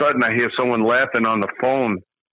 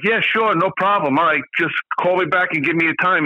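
eager agreement; 7 semitones higher than usual. Retold or imitated. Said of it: "I was like".

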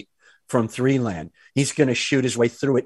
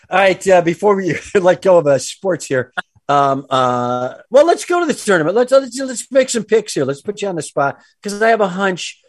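ordinary talk

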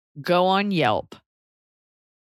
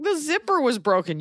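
high-pitched mock protest; mock yelling back; 7 semitones higher than usual. Customer yells back at the shop workers, mock protest. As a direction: protest in a raised pitch without real volume. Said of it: "If you yelled back".

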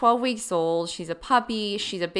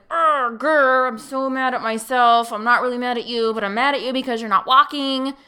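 mock growly grumble, then a quick vented rant; retold exasperation, louder and higher, picking up speed; frustrated; 4 semitones higher, 4 dB louder than usual. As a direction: grumble and rant, half in earnest. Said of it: "still frustrated".

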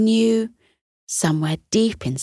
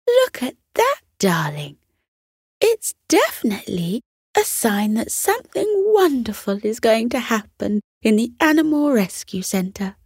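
high, eager mother's voice; a mum thrilled by the omen; excited mother; 5 semitones higher than usual. Acted.

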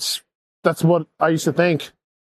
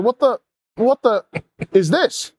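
baffled disbelief; 5 semitones higher, slower than usual. Retold or imitated. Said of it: "I was like".

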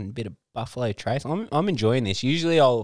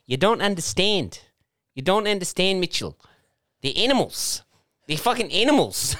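put-on high-pitched, emphatic South African accent; South African lady; 10 semitones higher than usual. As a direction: mimic an indignant South African woman. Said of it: "this South African lady".

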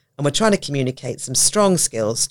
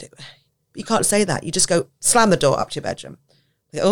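ordinary delivery

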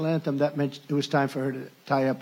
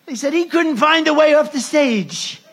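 much higher and louder mock voice; mocking the press; 10 semitones higher, 8 dB louder than usual. Speaker parodying reporters, high and loud. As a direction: squeal it like a gleeful reporter. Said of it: "In other words".

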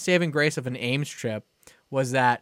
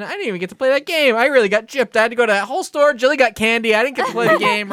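higher, louder aggrieved complaint; indignant whine; 9 semitones higher, 6 dB louder, faster than usual. Retold or imitated. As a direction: whine like a cheated kid. Kid whining about game.

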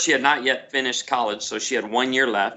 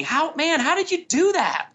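high-pitched mock-amazed young guy; imitating young guys. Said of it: "the young guys in our church will say to me".

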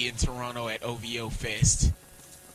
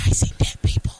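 whispering; 6 dB louder than usual. Retold or imitated. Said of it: "movie quote".

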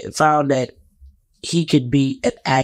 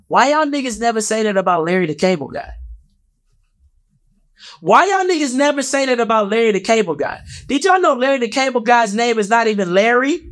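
high-pitched, incredulous challenge; indignant; 7 semitones higher than usual. Real, not acted. Calling out fans' double standard on Larry the Cable Guy, indignant. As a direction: demand an answer in a raised, protesting pitch.

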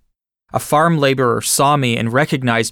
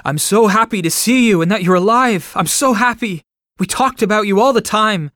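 overjoyed; 7 semitones higher, 3 dB louder than usual. A narrator's reading. Farmer overjoyed at survivor's return.

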